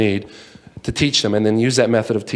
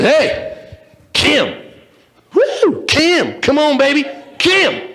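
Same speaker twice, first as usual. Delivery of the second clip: pitched way up, calling out to the town beauty; hollering at a girl; 14 semitones higher, much slower than usual. Acted. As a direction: holler after her like a lovestruck cowboy. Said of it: "he starts to holler at this girl".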